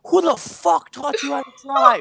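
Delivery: high, loud mock road-rage yell; hammed-up outraged holler; aggressive Boston driver. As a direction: yell it out the window, imitating him. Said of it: "he was a really aggressive driver".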